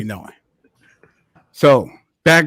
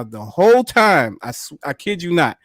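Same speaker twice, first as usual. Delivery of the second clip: ordinary delivery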